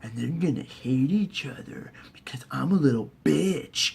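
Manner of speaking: soft, slow scheming whisper; hushed mock-sinister plotting; creepy whisper. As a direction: hiss the plan like a sneaky villain. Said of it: "and he's just like".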